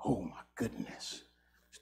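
breathy whisper under the breath; whispered aside. Real, not acted. Preacher to himself, whispering at the silence. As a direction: mutter it to yourself, barely audible.

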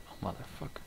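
hissed under the breath; whispered curse. Real, not acted. Gamer muttering at the enemy, whispered curse.

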